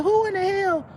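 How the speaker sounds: high, put-on indignant squeal; indignant outburst. Upset sister reacting to the move, high and indignant. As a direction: shriek it like an offended sister. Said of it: "she's like".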